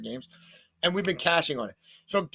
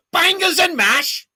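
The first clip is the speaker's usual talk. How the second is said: much higher, louder, slow mock British accent; 11 dB louder, slower than usual. Host's best try at the catchphrase, loud mock British accent. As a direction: belt out Bangers and mash in a hammed-up British accent. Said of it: "Was that better?".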